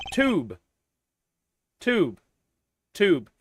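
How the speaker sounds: British accent demo. Shown as a demonstration. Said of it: "making fun of my accent".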